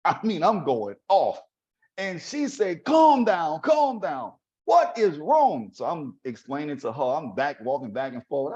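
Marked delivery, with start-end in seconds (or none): 2.8-5.7 high-pitched, loud, drawn-out motherly scolding; exasperated mother. Retold or imitated. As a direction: order him to settle down, pitched up high. Mother calming angry student.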